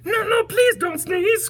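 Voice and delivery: high monkey voice pleading. Acted. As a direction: beg like tiny frightened monkeys. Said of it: "cried the monkeys".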